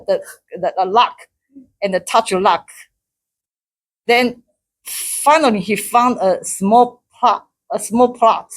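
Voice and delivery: ordinary delivery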